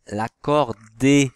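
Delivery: l'accordéon said slowly and loudly, syllable by syllable; slow clear demo word. Shown as a demonstration. Teacher to pupils, spelling out a word slowly and loudly. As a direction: stretch out l'accordéon so each syllable rings. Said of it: "Donc si vous entendez et".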